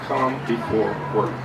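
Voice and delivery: ordinary delivery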